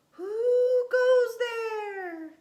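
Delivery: high, loud, long-drawn call; holler. A narrator's reading. Space kitten challenges a stranger, drawn-out high holler. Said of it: "hollered Scapito".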